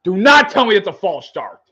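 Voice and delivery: high, loud, pleading at the flag; real fan outrage shouted at the TV; exasperated shout. Real, not acted. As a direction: beg the refs not to call it, loud and high. Fan watching penalty flags.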